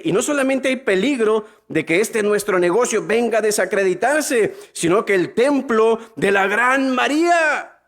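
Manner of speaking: lifted preacher's scripture voice; scripture reading. Acted. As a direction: read the verse aloud to the crowd. Reads Bible verse aloud.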